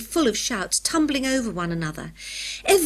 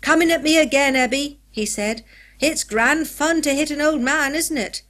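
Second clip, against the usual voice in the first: gleeful taunt; 6 semitones higher, 5 dB louder than usual. A narrator's reading. Jeremiah taunting Ebenezer.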